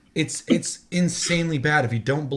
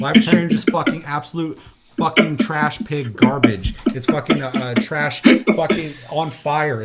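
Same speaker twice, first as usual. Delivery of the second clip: louder, hammering each insult; heated rant, louder than the chat around it; angry rant; 7 dB louder, slower than usual. Real, not acted. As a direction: vent your hatred of the game, loud. Ranting about a hated game.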